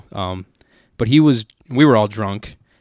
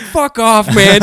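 loud, high-pitched dismissive shout; imitated drunken yell; 10 semitones higher, 8 dB louder, slower than usual. Retelling friend's yell at the dad, mock shout. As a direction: holler it like a drunk pal.

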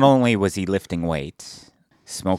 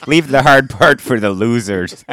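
loud quote; 3 semitones higher, 10 dB louder than usual. Retold or imitated. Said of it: "No, he said".

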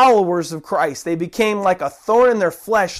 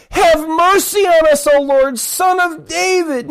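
higher-pitched cry for mercy; 7 semitones higher than usual. Acted. Blind men calling to Jesus, crying out. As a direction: call it out to be heard over a crowd. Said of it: "they cried out all the more".

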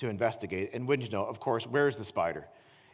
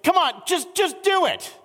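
much higher, louder exasperated plea; frustrated; 8 dB louder than usual. Retold or imitated. Husband losing patience with his reluctant wife, exasperated. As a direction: push her, fed up and loud. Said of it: "getting frustrated".

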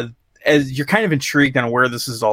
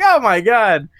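higher, disbelieving cry of oh my God; exasperated disbelief; 7 semitones higher than usual. Retold or imitated. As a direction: react to the awful line in mock horror. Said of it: "I'm like".

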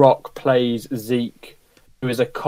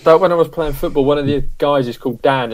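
ordinary talk

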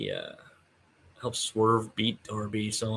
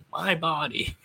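put-on a higher, childish "my body"; childlike mock protest; 6 semitones higher than usual. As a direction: voice a little kid standing up for herself. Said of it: "or, like, you know".

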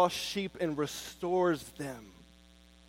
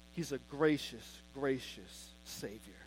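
soft adoration; 5 semitones lower, 11 dB quieter than usual. Real, not acted. Soft repeated praise.

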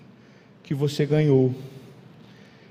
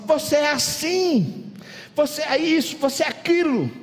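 jab each accusation in a raised pitch; quarrelling sibling; 8 semitones higher than usual. Acted. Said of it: "tá lá uma briga".

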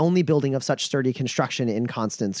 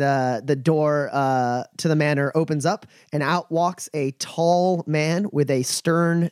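usual speaking voice

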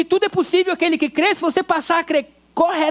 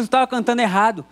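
ordinary talk

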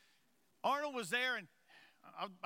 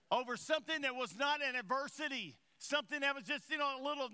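raised, higher-pitched pulpit voice; forceful preaching; 4 semitones higher than usual. Real, not acted. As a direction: drive the point home to the congregation.

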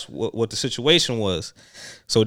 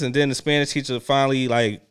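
usual speaking voice

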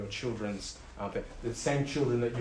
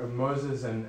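usual speaking voice

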